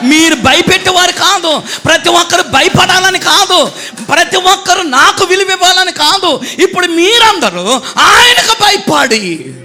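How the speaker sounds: shouted pulpit exhortation; full-force preaching. Acted. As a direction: press the point on the crowd.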